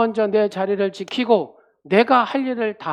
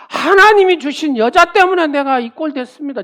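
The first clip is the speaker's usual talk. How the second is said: higher, louder aggrieved complaint; complaining Adam; 7 semitones higher, 10 dB louder than usual. Retold or imitated. Adam protesting to God, loud and aggrieved. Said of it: "아담이 말하는".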